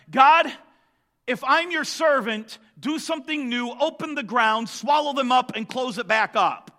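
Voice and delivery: loud, high, confident call to God; bold prayer. Retold or imitated. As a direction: challenge the rivals through prayer. Moses prays in the contest.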